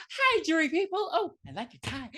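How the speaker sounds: high-pitched, gushing mock greeting; bubbly. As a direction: play a chirpy defendant charming the jury. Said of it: "bubbly as ever".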